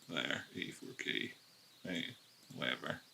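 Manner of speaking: breathy whisper of the number. Acted. Trader whispering to himself while drawing the line. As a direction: whisper it to yourself as you mark the chart.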